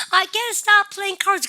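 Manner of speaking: I can't stop playing cards pitched high with feeling; emotional. Shown as a demonstration. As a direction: sing it out, full of longing. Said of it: "감정을 표현하고 싶은 것 같아".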